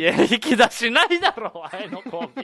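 loud, high protest breaking into laughter; laughing retort. Real, not acted. Co-host balks at partner's fly-mating segue, laughing retort. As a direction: laugh while objecting, voice jumping up.

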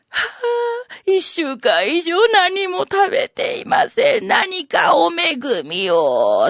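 lightly voiced starving beggar woman, high then slow; a hint of a frail beggar woman's thin plea; thin weak voice. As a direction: plead faintly as if about to faint. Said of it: "か細い声で言うたわな".

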